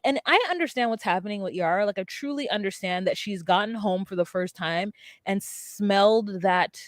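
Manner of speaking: usual speaking voice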